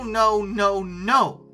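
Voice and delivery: normal talking voice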